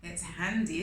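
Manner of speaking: plain reading voice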